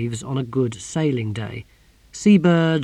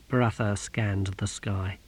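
ordinary delivery

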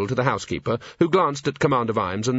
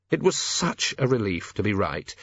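ordinary delivery

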